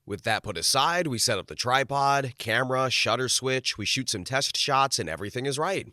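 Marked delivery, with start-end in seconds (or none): none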